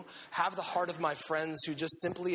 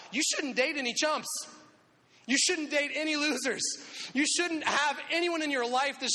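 emphatic; 8 semitones higher than usual. Real, not acted. Preacher exhorting.

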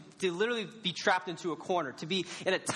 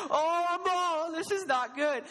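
mock panic; 11 semitones higher, slower than usual. Recalling his paintball dread, high panicky laughing yelp. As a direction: squeal it like you just realized you're doomed. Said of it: "So I'm like".

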